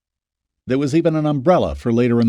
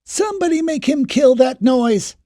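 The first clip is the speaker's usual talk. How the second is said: angry shout; 4 dB louder than usual. Acted. Woman shouting from her yard.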